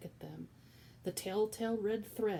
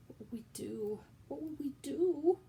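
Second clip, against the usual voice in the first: wailing; 8 semitones higher, 3 dB louder than usual. Acted. Cook wailing in despair to Louise. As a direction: whisper rising to a high wail. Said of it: "wailed Cook".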